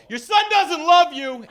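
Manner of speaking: high, loud, slow insult; high hollered jab; parting shout. Retold or imitated. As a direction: yell it out the window. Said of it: "I go".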